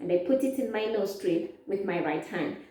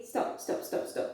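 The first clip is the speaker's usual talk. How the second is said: whispered urgent stop; whispered warning, stop stop; 9 dB quieter than usual. Pretend. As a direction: hiss a quick stop. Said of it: "If you are those people who do that".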